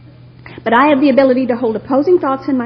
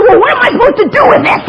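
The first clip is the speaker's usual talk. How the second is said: high, loud laughing bewilderment; asking the sponsor in comic panic; flustered plea; 11 semitones higher, 7 dB louder than usual. Retold or imitated. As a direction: demand an answer, frazzled. Bewildered by her anger.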